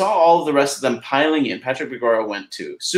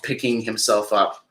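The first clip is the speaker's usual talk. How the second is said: ordinary delivery, nothing marked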